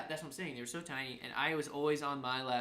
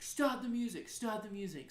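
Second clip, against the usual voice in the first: higher-pitched urgent calling out; retold urgent call; 7 semitones higher than usual. Roommate telling DJ to stop the music, calling out. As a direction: imitate calling over party music.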